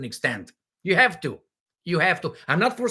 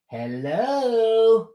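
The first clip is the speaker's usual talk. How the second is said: calling out; 3 semitones higher than usual. Real, not acted. Calling out to someone missing.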